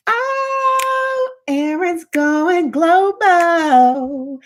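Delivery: high, very slow sing-song announcement; excited. Real, not acted. Hyping up a viewer on the live stream, slow and sing-song. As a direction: stretch it out, delighted.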